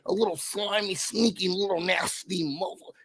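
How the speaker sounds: soft snide muttering; muttered insult. Real, not acted. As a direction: sneer it under your breath. Insulting Quinn under breath.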